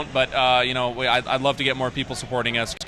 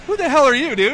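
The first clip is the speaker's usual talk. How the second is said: indignant yell; 11 semitones higher, 7 dB louder than usual. Retold or imitated. Angry phone argument with caller.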